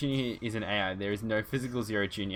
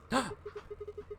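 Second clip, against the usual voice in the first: high surprised gasp; 8 dB quieter than usual. Acted. Mock gasp at box.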